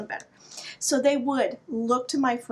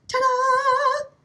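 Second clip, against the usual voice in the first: much higher, louder triumphant ta-da; ta-da reveal; 12 semitones higher, 4 dB louder than usual. Retold or imitated. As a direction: sing out the big reveal. Reveal of the can opener.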